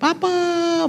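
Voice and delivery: put-on high, excited child's call from a distance; a little kid spotting dad and calling out; child calling Papa. Children running to their father, calling Papa with joy.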